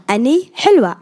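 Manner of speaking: Ani hilwa said loud and high, syllables pressed out; teaching Arabic syllables. Shown as a demonstration. Aunt drilling her niece in Arabic, loud and high. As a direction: coach the phrase Ani hilwa loudly. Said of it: "Now all together".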